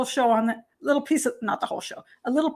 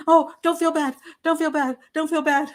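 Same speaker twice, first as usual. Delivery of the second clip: mock consoling; 4 semitones higher than usual. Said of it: "Everybody's like".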